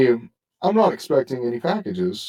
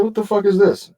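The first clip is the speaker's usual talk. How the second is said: angry outburst; 11 semitones higher, 5 dB louder than usual. Retold or imitated. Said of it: "And I said".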